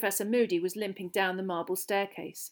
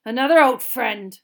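loud, higher growl; 4 semitones higher, 13 dB louder than usual. A narrator's reading. Teacher hearing Snape's name, growling. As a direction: growl it out forcefully. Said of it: "growled Moody".

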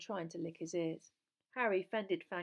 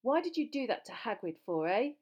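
raised, indignant demand; louder, higher accusing question; furious; 5 semitones higher, 6 dB louder than usual. A narrator's reading. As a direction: angrily demand an explanation. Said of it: "said Harry furiously".

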